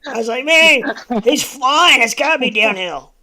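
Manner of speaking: high, loud and laughing; bursting with laughter as you say it; laughing excitedly. Real, not acted. Laughing, retelling their own comment.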